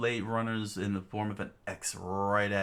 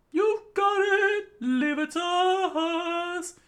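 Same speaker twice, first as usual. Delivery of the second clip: very high, slow, lilting cheer; high sing-song girl; 7 dB louder, much slower than usual. Acted. Fuko answering Tatiana, high slow sing-song cheer. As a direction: sing it out bright and eager.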